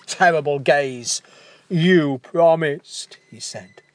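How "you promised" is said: reproach. Acted. Dying giant reproaches Leo.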